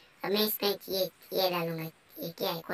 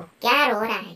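loud teasing; 3 semitones higher, 11 dB louder than usual. Real, not acted. Character cries in game.

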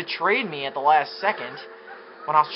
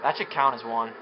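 ordinary talk, nothing marked

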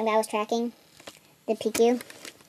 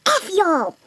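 higher-pitched mock outburst; mock angry cussing; 8 semitones higher than usual. One guy quitting the party, mock angry outburst. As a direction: swear them all off in mock anger. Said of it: "this one guy's all like".

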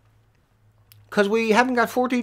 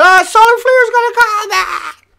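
high, slow sing-song mockery; mocking quote; 11 semitones higher, 5 dB louder than usual. Streamer mocking doom-sayers in chat, high slow mock whine. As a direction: parrot them in a silly high voice. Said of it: "All you people running around talking about".

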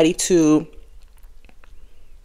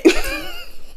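laugh; 6 dB quieter than usual. Real, not acted. Laugh after advice.

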